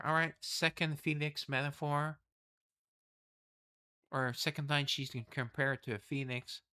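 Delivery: normal talking voice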